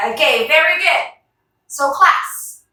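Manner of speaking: ordinary talk, nothing marked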